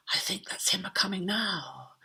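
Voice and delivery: hushed, hopeful whisper; whisper, straining to listen; anxious whisper. Acted. King in bed, whispering in hope. King hears Father Christmas coming.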